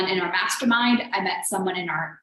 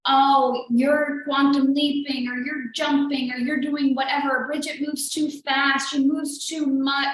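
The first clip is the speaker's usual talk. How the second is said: mocking critics; 5 semitones higher than usual.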